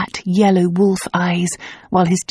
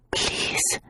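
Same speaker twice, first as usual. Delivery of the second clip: barely voiced, soft plea; a woman whispering for her lover to touch her; breathless whisper; 11 dB quieter than usual. A narrator's reading. Woman pleading in the dark.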